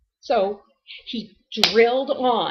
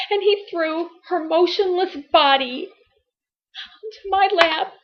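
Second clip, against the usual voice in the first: high, tearful voice with long pauses; anguished grief; 7 semitones higher, 5 dB louder than usual. Acted. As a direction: tell it through tears, pitched high and halting. Mother recounts baby going lifeless.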